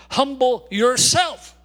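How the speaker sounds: loud, slow, each word pressed down; emphatic command. Acted. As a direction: order the church to humble itself. Preacher commands the congregation.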